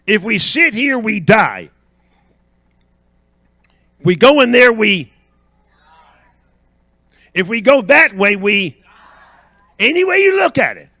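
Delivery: forceful preacher building the refrain; call-and-response preaching. Real, not acted. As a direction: lead the congregation, leaving gaps to answer. Leads congregation in refrain.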